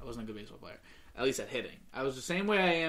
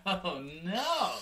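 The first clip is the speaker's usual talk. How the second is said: high-pitched retold dread; mock alarm; 7 semitones higher than usual. Pitcher seeing the ball right at his face, mock alarm. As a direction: draw it out high, playing up the alarm.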